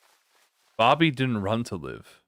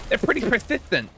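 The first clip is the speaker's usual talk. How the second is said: higher voice with a lisp; 10 semitones higher than usual. Acted. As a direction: lisp the s sounds. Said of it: "Why did I give her a lisp?".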